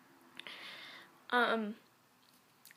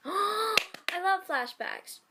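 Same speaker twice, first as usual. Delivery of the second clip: slow, high gasp of delight; 8 semitones higher, much slower than usual. Real, not acted. Reader gasping with delight at the flashback. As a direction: swoon over the flashback with a gasp. Excited aside while reading fanfic.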